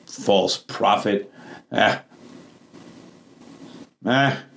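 low, deflated dismissal with long pauses; disappointed doubters. Acted. Preacher acts out watchers doubting the prophet, low disappointed grumbling. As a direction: imitate onlookers giving up, pitched low.

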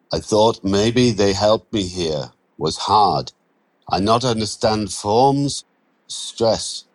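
put-on weary broken English, Romanian accent. Andrei telling Mitch about the forms, Romanian accent. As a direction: Romanian accent, mild frustration.

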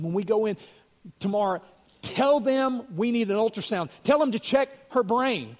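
wife urging the husband before surgery, excited and loud; urgent insistence. Retold or imitated. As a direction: insist to the husband that a check is needed now. Urgent plea to her husband.

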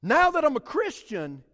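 loud, high, driving sermon voice; preaching at full force. Acted. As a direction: preach it out to the whole church.

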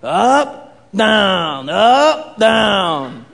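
sensei coaching, rising and falling with each move; Mister Miyagi. Retold or imitated. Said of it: "He's like".

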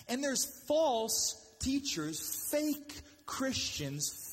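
slow emphatic list. Real, not acted.

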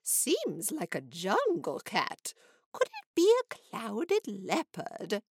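slow, musing lady's voice; Missus Nestrom wondering. A narrator's reading. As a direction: wonder aloud slowly about the cat. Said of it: "she added".

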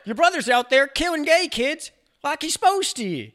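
very high, loud cartoon scolding; mock angry dad. The father berates his son, very high and loud. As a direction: scold him over his brother.

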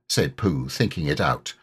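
plain reading voice

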